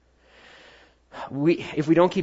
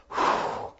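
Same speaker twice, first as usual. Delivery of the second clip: a breathy whew, blown out like relief; unvoiced whew of relief; 7 dB quieter than usual. Congregation reacts to Jesus' command with an airy whew. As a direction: let out a soft airy whew.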